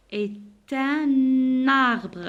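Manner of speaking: "Est un arbre" very slowly as é-tun-narbre; syllable-by-syllable "Est un arbre" with both links sounded; liaison. Shown as a demonstration. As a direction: stretch "Est un arbre", N linked into arbre. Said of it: "la liaison entre le N et le A".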